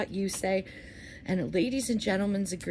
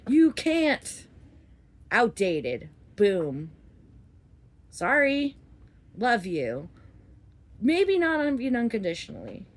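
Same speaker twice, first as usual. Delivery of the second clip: worked-up yelling; 3 semitones higher, 6 dB louder than usual. Real, not acted. Venting about parents' outdated values, real worked-up yelling. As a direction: let frustration burst out in short loud jabs. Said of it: "It's either I yell or I cry".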